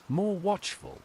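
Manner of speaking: ordinary delivery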